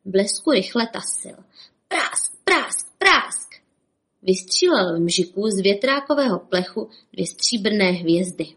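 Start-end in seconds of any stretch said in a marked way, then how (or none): 1.9-3.5 high, punchy bangs, the last loudest; imitated gunshots. A narrator's reading. Sheriff shoots at the fan, high explosive bangs. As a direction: fire the words off like pistol shots. Narrator imitating rapid pistol shots.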